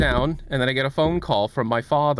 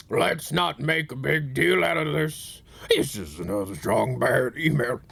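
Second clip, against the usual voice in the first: Strong Bad impression; 4 semitones higher than usual. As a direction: growl it out lazily like a cocky cartoon wrestler. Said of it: "a perfect impression of Strong Bad".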